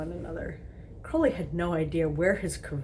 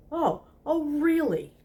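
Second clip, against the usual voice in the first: high hammed-up indignant "really"; sarcastic huffy disbelief; mock outrage; 11 semitones higher, 5 dB louder, slower than usual. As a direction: play scandalised, much higher and louder.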